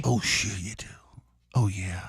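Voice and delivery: low breathy whisper. Pretend. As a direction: purr it in a hushed whisper. Teasing sexy aside.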